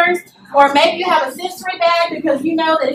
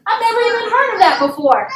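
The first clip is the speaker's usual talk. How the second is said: baffled; 5 semitones higher than usual. Retold or imitated. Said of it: "everybody would look at me like".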